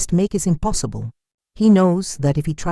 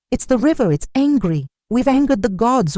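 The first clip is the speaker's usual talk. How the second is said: higher, alarmed villager crying out; frightened villager, voice pitched up in alarm; panicked cry; 8 semitones higher than usual. A narrator's reading. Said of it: "one cries".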